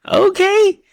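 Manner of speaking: very high, squeaked okay; squeaky disbelief. Real, not acted. Amused by the odd team choices, high squeaked okay. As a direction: yelp it out in shock.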